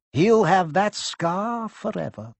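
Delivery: slow, grave headmaster; Dumbledore voice. A narrator's reading. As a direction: state it gravely and slowly.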